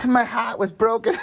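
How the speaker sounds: high, hammed-up Boston accent. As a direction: put on your best Boston accent, laughing at it. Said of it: "do your best Boston accent".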